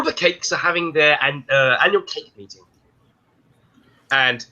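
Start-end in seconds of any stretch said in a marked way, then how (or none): none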